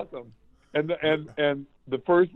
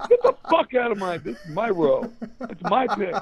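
higher-pitched possessive protest; mock territorial anger; 7 semitones higher than usual. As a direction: warn off a rival, half-hearted fury. Acted shove during a fight.

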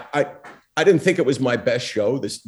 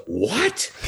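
higher, rising retort; incredulous one-word question, pitched up; taken aback; 5 semitones higher than usual. Retold or imitated. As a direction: imitate an affronted double take. Opponent reacts to a provoking question.

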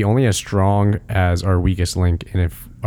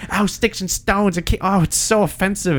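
mocking outrage; 11 semitones higher than usual. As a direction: parody a pearl-clutching critic. Mocking people outraged at special.

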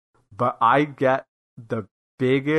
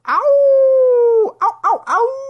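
loud high yelps of pain; sharp ouch; 9 dB louder, much slower than usual. Real, not acted. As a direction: cry out as if stung. Sudden pain outburst.

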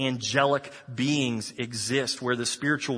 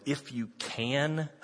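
slow, softer, teasing "if you can"; drawn-out, gently ironic; slow wry echo; 6 dB quieter, slower than usual. Acted. Jesus echoes father, amused.

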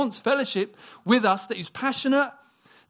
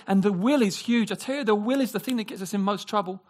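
ordinary talk, nothing marked

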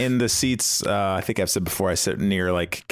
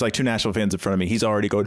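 ordinary talk, nothing marked

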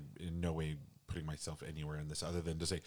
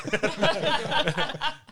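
loud high burst of laughter; real high laugh; 13 dB louder than usual. Real, not acted. Laughing at own story.

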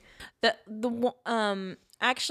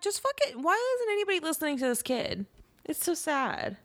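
much higher, fast exasperated rant; exasperated outburst; 9 semitones higher, faster than usual. Real, not acted. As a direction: vent at the doctors, fast and high. Exasperated reaction to the movie.

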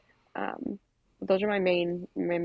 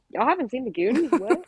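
mock disbelief; 5 semitones higher, 4 dB louder than usual. Mocking future kids, incredulous.

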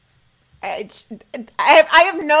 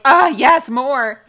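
mock delight; 3 semitones higher, 8 dB louder, much slower than usual. Reacting to cast videos.

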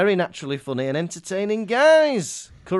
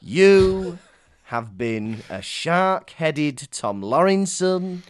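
grand announcer; much slower than usual. Acted.

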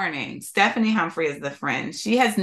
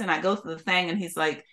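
usual speaking voice

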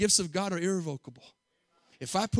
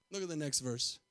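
normal talking voice

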